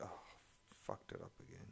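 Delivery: ordinary delivery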